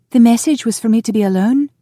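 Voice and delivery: high, loud female outburst; shrilly pitched, heated objection; exploding protest. A narrator's reading. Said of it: "she exploded".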